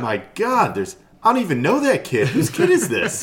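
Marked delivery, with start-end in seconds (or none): 1.2-3.2 laughing in disbelief. Real, not acted. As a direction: laugh through the words. Laughing at a stranger's kid.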